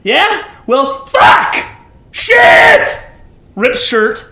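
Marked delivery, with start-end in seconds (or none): 0.0-3.0 building from high challenge to shouted curses; blasphemous acted tirade, rising; defiant outburst. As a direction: yell the swearing, louder and higher.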